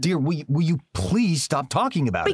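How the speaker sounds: pleading in sharp exasperation; exasperated plea. Acted. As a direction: beg her to drop it, losing patience. Exasperated husband cuts off wife.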